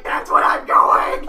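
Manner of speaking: loud, slow, strained cry of dismay; mock horrified shout. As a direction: yell it in comic panic. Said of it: "And I'm like".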